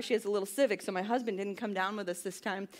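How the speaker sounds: usual speaking voice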